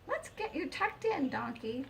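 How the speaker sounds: high, fussing hen voice tucking him in; clucking hen. A narrator's reading. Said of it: "clucked the Ruby".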